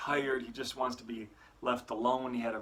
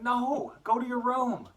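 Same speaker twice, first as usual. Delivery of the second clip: very high, louder, sharp "go to your room"; angry dad shout; 12 semitones higher, 6 dB louder than usual. Acted. Dad sending his son to his room, angry shout. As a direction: bark the boy off to his room.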